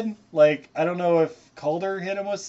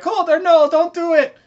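much higher and louder desperate plea; mock pleading woman; 13 semitones higher, 10 dB louder than usual. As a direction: cry out to stop him in a high, hammed-up voice.

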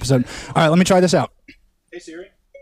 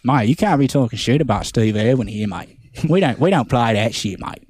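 put-on Australian accent; 4 semitones lower than usual. Australian warning a visitor about Steve Irwin, mock Australian accent. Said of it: "You gotta go to Australia".

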